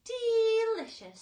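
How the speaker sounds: very high, loud, drawn-out delight; old grasshopper relishing the peach, very high and loud. A narrator's reading. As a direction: savour the word like a dotty old insect. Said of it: "said the old green grasshopper".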